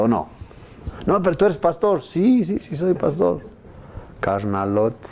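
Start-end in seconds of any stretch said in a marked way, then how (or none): 1.0-2.0 accusing voice. Retold or imitated. Accuser quoted. 2.0-3.4 sheepish admission. Retold or imitated. Pastor admits sheepishly.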